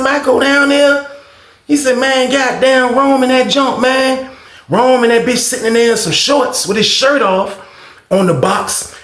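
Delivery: very high-pitched excited gossip; scandalized report. Retold or imitated. Roommate reports on the pair in the cell, imitated scandalized gossip. Roommate recounts shocking sight.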